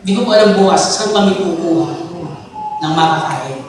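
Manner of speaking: quiet, pleading prayer that fades away; a hint of weeping in a soft prayer; tearful prayer. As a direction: plead softly with the Lord, a touch of tears. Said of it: "umiiyak ka".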